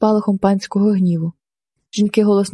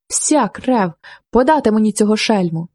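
usual speaking voice

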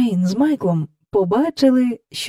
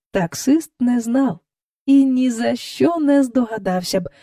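ordinary delivery, nothing marked

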